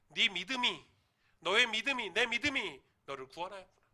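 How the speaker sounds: loud, ringing declaration; proclaiming Jesus. Retold or imitated. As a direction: boom it out as Jesus blessing the blind man. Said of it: "예수님이 뭐라고 하셨습니까". (크게 선포하는 예수님 목소리)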